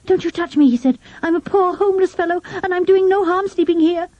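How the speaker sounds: put-on high-pitched boy voice, defiant then pleading; a cornered schoolboy bluffing as a tramp. A narrator's reading.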